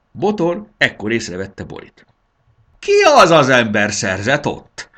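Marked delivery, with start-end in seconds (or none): 2.8-4.9 loud, raised bellow. A narrator's reading. Old dragon suddenly notices the human girl, bellowing. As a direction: bellow the question in outrage. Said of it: "bődült fel".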